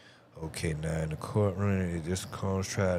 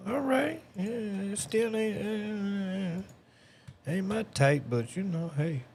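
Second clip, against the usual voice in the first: high mocking voice; 4 dB louder than usual. Real, not acted. Mock outburst about the lyric.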